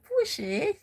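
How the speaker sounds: very high "Pushi" for bu shi; mimicked Mandarin reply; Chinese reply. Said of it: "and they will say".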